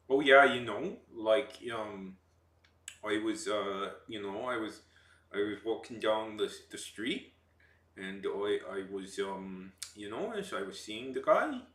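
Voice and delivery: hesitant, checking-in Canadian accent with upturns. Shown as a demonstration. Streamer showing the trick of doing one, Canadian accent. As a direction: do a Canadian accent, seeing if everybody's okay. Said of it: "like, a Canadian accent".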